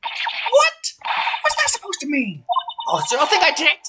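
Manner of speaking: put-on high, outraged old lady; shrill granny yelling at the prosecutor; overacted anime dub. Old lady voiced in court.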